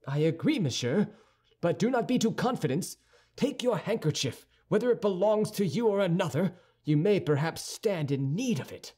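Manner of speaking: high-pitched, impatient retort; quarrelsome young Gascon. A narrator's reading. D'Artagnan warning Aramis about Gascons, quarrelsome.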